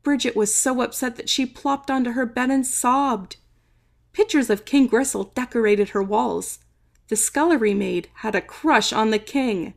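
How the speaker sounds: usual speaking voice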